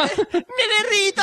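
high, slow melting wail through laughter; laughing mock wail. Melting joke amid laughter.

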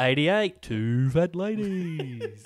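loud, drawn-out bingo call; bingo caller. Acted. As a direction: sing out the number like a bingo caller, stretching it. Mimicking a bingo caller's calls.

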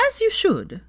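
high, firm approval. Acted. Sophia firmly backing Hermione, high pitched. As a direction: settle it with certainty. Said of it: "said Sophia firmly".

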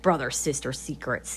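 ordinary delivery

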